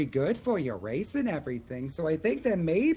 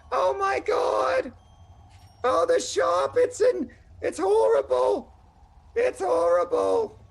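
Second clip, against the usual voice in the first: high, loud, slow panicked shriek; panicked screaming; 4 dB louder, slower than usual. Acted. Bloodied gnome bursting into the tavern, screaming in panic. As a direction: scream it out in terror over the shop. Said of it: "he just starts screaming that".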